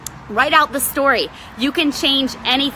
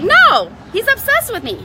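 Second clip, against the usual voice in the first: high, gushing mock brag; giddy bragging; 11 semitones higher than usual. Wife bragging that her husband follows her around, high and giddy. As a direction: gush like a smitten wife showing off. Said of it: "to like".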